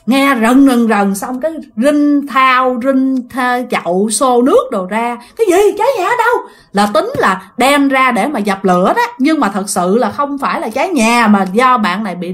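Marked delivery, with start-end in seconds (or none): 5.4-6.4 alarmed neighbor. Retold or imitated. Neighbors rushing with water.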